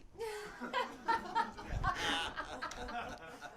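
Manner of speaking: high, long burst of real laughter. Real, not acted. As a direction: crack up at your own joke. Punchline of his joke.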